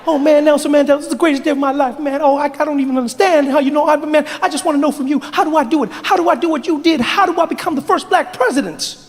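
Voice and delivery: high, rapid-fire fanboy babble; excited fast talk. Acted. Barack begs Mandela for advice, high and racing. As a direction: gush at top speed in a high young voice. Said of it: "You are speaking so fast".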